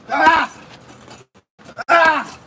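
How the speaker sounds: sharp bursts of effort on every strike; punch exertion cries. Real, not acted. Boxer throwing punches in training, effort cries. Punching through a workout round.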